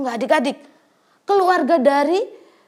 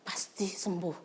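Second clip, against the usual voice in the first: hushed inner thought; 4 semitones lower, 10 dB quieter than usual. Acted. Woman's inner hopeful thought.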